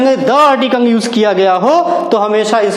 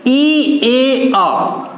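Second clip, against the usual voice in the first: both words spelled very slowly, letter by letter; teacher dictating two spellings side by side; much slower than usual. Shown as a demonstration. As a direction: spell them out for the class, unhurried. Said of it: "इसका pronunciation".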